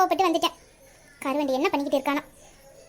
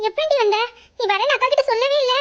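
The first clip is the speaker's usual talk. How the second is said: high, tearful voice; 6 semitones higher than usual. Acted. Sister asking why she came unannounced, tearful. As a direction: ask through tears, pitch raised. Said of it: "நீனும் அழுவாதக்கா".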